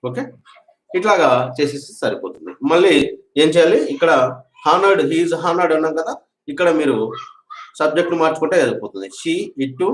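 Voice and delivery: normal talking voice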